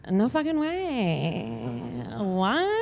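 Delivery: soft, drawn-out mock shock; hushed disbelief. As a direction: let the words stretch, quietly stunned. Shocked reaction to friend's sighting.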